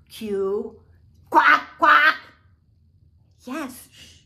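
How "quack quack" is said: shouted duck quack, high and loud; be a noisy duck. Pretend. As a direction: quack loudly like a toy duck.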